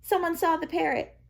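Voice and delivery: high, loud urgent call; yelled. A narrator's reading. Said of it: "she yelled".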